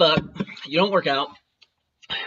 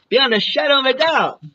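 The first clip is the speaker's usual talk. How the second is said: mocking repeat; 6 semitones higher than usual. Mocking the witness statement.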